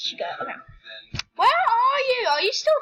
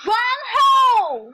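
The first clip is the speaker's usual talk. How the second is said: loud drawn-out shout; 7 semitones higher, 8 dB louder, much slower than usual. Real, not acted. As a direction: yell it out slowly. Shouting at sister in game.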